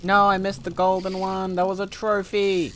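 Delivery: loud, high groan of dismay; frustrated outcry. Real, not acted. Gamer missing the golden trophy fish, loud dismayed cry.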